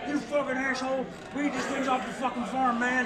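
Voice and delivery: mock the drunk local's outburst; angry local. Teller imitating the local guy at the bar, lightly yelling. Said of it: "he's like".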